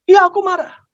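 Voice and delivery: high, indignant outburst; angry. Acted. Child admitting anger to papa, angry. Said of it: "Kamu marah?".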